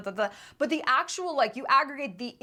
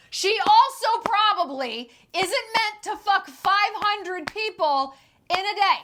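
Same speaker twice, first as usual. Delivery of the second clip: slow, higher, loud incredulous point; exasperated, raised voice, drawn out; emphatic rebuttal; 6 semitones higher, 5 dB louder, slower than usual. Real, not acted. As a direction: drive it home word by word. Indignant rebuttal.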